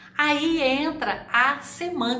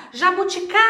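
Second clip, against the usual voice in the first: JABUTICABA called out high, CA held long; calling the word; 6 semitones higher, 3 dB louder, much faster than usual. Shown as a demonstration. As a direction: call out JABUTICABA, stretching the CA. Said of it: "pronunciar e chamar a palavra".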